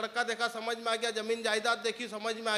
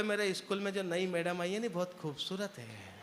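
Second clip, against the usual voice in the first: put-on child's hushed confession; 6 semitones lower, 7 dB quieter than usual. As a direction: lower and much softer, secretive. Son confides about teacher.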